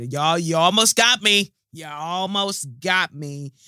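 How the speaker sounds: loud, slow, strained "you almost got me"; fighting back tears with a loud laughing protest; choked-up exclaim. Real, not acted. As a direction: caught off guard by the letter, nearly crying. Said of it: "That made me feel a bit teary".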